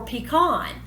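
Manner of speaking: pecan said pee-KAHN, stress on the second syllable; regional pronunciation. Shown as a demonstration. Host offering viewers the other pronunciation, pecan said pee-KAHN. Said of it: "let me know how you pronounce".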